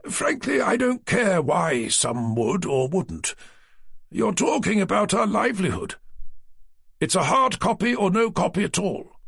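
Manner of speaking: he won't budge an inch; indignant publisher. A narrator's reading. Naylor, indignant ultimatum.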